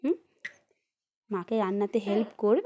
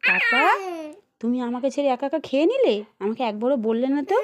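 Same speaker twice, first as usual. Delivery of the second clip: hammed-up hurt child voice, louder; sulky child complaint; 2 semitones higher, 4 dB louder than usual. Shown as a demonstration. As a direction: show the baby how to complain to papa. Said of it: "আমি শিখিয়ে দিচ্ছি এভাবে বলবা".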